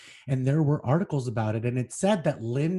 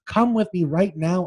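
begging; 5 semitones higher, 5 dB louder than usual. Acted. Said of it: "I begged".

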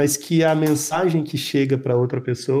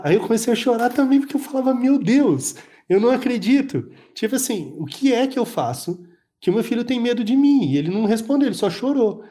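close to tears; 7 semitones higher than usual. Real, not acted. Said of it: "Eu fico com vontade de chorar só de lembrar".

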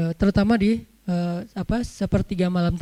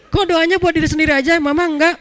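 high, loud mock complaint; nagging wife; 6 semitones higher, 5 dB louder than usual. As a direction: play a wife protesting at being left out. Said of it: "Istri ngomel".

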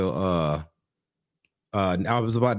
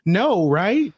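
much higher, sweet daughter voice; 10 semitones higher, 3 dB louder than usual. Retold or imitated. Daughter reassuring her dad, high and sweet. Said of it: "she was like".

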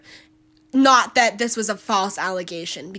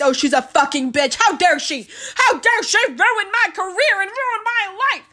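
higher, mocking take on an indignant man; mocking AJ's voice; 9 semitones higher than usual. Reviewer mocking AJ complaining about his accuser, pitched up. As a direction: sneer it out as the character, pitched up. Quoting the film's character AJ.

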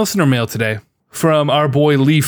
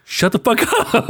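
high mock snap broken by a laugh; fake-angry, cracking up; laughing shut-up at a friend; laughing retort; 9 semitones higher than usual. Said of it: "I'm like".

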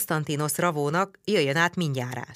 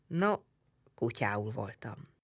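usual speaking voice

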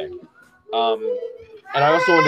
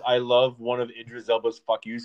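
ordinary talk, nothing marked

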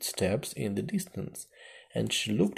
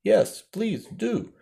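high, loud, drawn-out plea; eager chorus; 11 semitones higher, 6 dB louder, slower than usual. A narrator's reading. Others calling after the mouse, pleading in chorus. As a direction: call out slowly, pitched right up. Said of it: "the others all joined the chorus".

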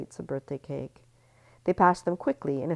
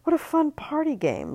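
party game glee; 13 semitones higher, 7 dB louder than usual. Acted. Libby's expression voiced.

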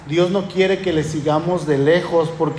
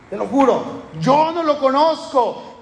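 yo no lo conozco pitched much higher and slowed; Peter's sworn denial; 6 semitones higher than usual. Retold or imitated. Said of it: "Negación con juramento".

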